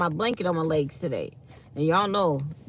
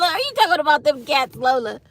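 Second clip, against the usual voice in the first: laughing; 9 semitones higher, 8 dB louder than usual. Real, not acted. Teasing the co-host about cats.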